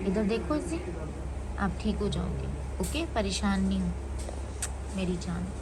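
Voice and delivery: soft, tender comforting; mother soothing her sick son, soft and tender. Real, not acted. Soothing sick baby in emergency.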